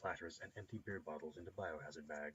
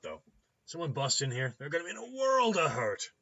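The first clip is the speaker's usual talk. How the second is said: loud, higher, boastful mechanic-doctor; boisterous clinic owner; 6 semitones higher, 11 dB louder than usual. Acted. Clinic owner explaining his fortified door, boisterous and loud. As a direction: brag and warn at high volume.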